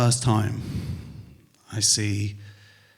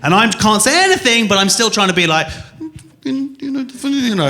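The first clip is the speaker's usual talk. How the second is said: strained placating; 10 semitones higher, 4 dB louder than usual. Retold or imitated. Strained placating of his wife.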